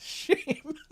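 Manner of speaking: high, breathy burst of laughter; amused laugh. Real, not acted. Viewer joke about watch list, laughing. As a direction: laugh it off, then a mock-sad word.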